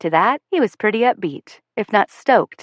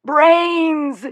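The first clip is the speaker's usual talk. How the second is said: high, loud zombie cry; girl lunging at the boys, zombie yell; 9 semitones higher, 3 dB louder than usual. A narrator's reading. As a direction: lunge and howl it. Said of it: "I yell".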